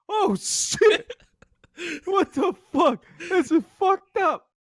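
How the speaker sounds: high, laughing shock; high-pitched laughing shock; laughing disbelief. Real, not acted. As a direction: laugh through the outrage. Reacting while laughing.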